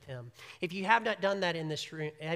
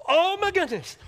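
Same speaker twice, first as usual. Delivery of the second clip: high, loud frantic fretting; panicked God; 3 semitones higher, 5 dB louder than usual. Acted. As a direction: play a panicking God wringing his hands. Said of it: "God's not up in heaven going".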